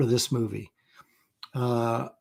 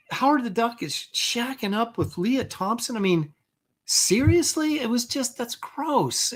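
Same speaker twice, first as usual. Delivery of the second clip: higher, aghast disbelief; appalled and incredulous; incredulous disgust; 8 semitones higher, slower than usual. Real, not acted. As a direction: rise in pitch like you can't believe it. Disbelief at the movie romance.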